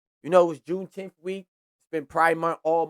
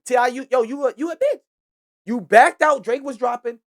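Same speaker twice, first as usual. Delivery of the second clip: calling out; 7 semitones higher, 8 dB louder than usual. Real, not acted. Calling out T.I. on podcast.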